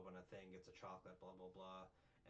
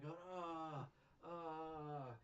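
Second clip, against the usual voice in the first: long, raised "ah" of pretend agony; mock pained groan; 7 semitones higher than usual. All floored after punches in the balls, mock pained groans. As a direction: groan as if hurting, hammed-up. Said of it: "on the ground in pain".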